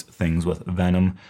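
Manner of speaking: ordinary talk, nothing marked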